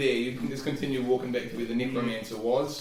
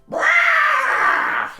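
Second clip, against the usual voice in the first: very high, very loud screeched roar; full-throated imitated dragon cry; distant dragon roar; 12 dB louder than usual. A narrator's reading. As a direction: let out a long, echoing beast bellow. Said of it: "you hear in the distance".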